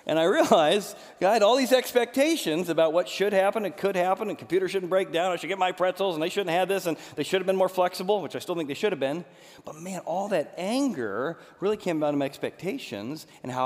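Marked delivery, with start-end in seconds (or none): 0.1-8.2 worked-up listing. Retold or imitated. Reliving his expectations.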